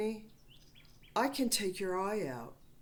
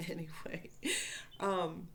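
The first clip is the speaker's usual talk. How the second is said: chuckling. Real, not acted. Speaker moving on after telling of battle, chuckling.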